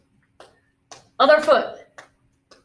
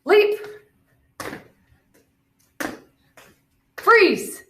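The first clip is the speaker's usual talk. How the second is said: called commands; 10 semitones higher than usual. Real, not acted. Coach shouting movement cues at students. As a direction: bark each cue across the gym. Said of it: "All right, ready?".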